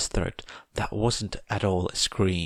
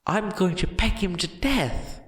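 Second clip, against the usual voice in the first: high, gleeful giant voice; a big dog promising to maul the rival; eager menacing giant; 11 semitones higher than usual. A narrator's reading.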